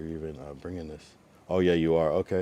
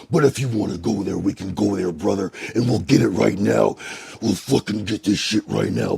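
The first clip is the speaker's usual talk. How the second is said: loud, higher fight-call shout; hyped challenge; 4 semitones higher, 12 dB louder than usual. Shown as a demonstration. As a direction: hype it up like calling out a rival. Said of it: "I could just turn the fuck up".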